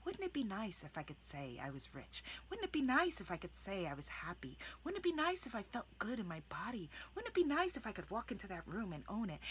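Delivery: quiet, whispered wondering; gently dream it aloud; soft wishful musing. Shown as a demonstration. Coach modelling softened affirmations, quiet and wishful. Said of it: "soften the energy for you".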